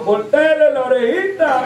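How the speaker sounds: mock holler up to someone far off; calling out. Boy on the ground calling up to the rider, mock holler. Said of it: "impecable le gritó".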